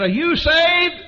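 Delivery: high, hollered question; yelling across room. Retold or imitated. Friend questions him across the lunch crowd, yelling. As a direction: shout the question over a crowd of diners. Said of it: "yelling across the people".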